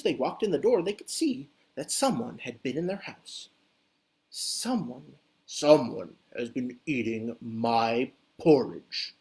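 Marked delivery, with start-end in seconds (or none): 4.3-9.1 low, slow Papa Bear voice; big papa bear rumbling low and slow; booming Papa Bear. Acted. Papa Bear finds his porridge eaten, low booming voice. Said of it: "in a great booming voice".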